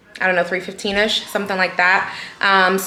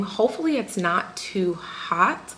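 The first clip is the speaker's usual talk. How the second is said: normal talking voice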